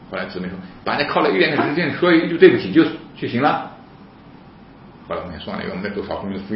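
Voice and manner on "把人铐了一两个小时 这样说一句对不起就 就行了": raised, loud retold complaint; indignant objection. Sister rejects police apology, indignant objection.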